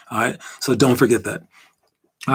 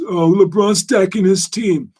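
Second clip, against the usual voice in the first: mock complaint; 3 semitones higher, 3 dB louder, slower than usual. Mimics fans arguing about the Lakers.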